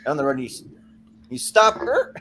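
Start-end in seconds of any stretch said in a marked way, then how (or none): 1.3-2.1 high mock grunt. Sudden stop sound.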